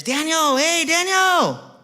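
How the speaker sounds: high, loud drawn-out holler; calling out. Retold or imitated. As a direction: holler the name across a car lot. Salesman calling across a car lot.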